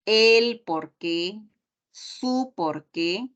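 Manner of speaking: el por qué and su por qué said slowly, el and su lifted; drawn-out teacher example, el por qué then su por qué; slow the phrase right down for students; slow careful demo. Shown as a demonstration. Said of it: "precedido de un determinante".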